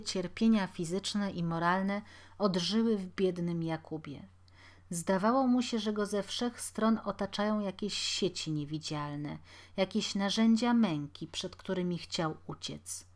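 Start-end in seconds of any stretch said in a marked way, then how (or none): none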